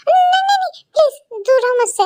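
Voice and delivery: high, frantic no; panicked refusal. Acted. Girl fending off boyfriend's kiss, squealing no. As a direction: push him off in a squeal. Pushing him away, panicked.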